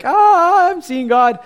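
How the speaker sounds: very high, loud, half-sung cry of awe; awestruck prophet. Retold or imitated. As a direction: belt it out like a man overwhelmed by God. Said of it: "he's like".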